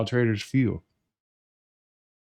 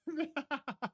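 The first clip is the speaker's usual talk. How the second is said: high airy laugh that fades out; breathless high giggle; 15 dB quieter than usual. Real, not acted. Streamer laughing at his own win, high breathless giggle. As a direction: giggle up high, almost silent. Gloating over winning trade.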